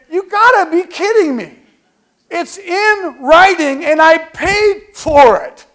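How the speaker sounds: loud, high, exasperated outburst; torqued. Retold or imitated. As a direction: let the anger burst out, slowing on the last line. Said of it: "How many knows I was torqued?".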